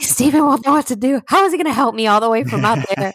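high, fast, mock-panicked inner voice; mock panicked thought.

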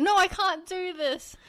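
high, drawn-out mock despair; exasperated struggle. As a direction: imitate someone giving up on the attempt. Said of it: "an internal battle with himself".

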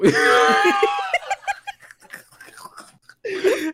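high, loud shrieking laugh. Real, not acted. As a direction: burst into a screaming laugh. Hysterical laugh.

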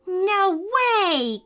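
put-on high, amazed little-boy cry; excited cry. A narrator's reading. Caleb spots Mickey at the park, amazed high cry. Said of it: "cried Caleb".

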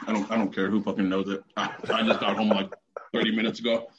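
talking while eating; words round a bite of food; mouth full. Real, not acted. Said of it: "I'm eating".

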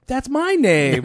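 high, loud, drawn-out retold outburst; mock astonished exclamation. Neighbor hears the family's name pointed out, hammed-up astonishment. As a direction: exclaim it slowly, playing up the surprise.

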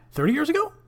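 sharp high rising double take; surprised. Real, not acted. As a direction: catch yourself with sudden disbelief. Catches own date slip.

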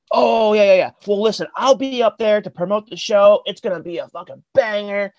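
high, loud, hyped-up brush-off; breezy promoter. Retold or imitated. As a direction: imitate an overeager promoter promising big. Promoter breezily hyping the show.

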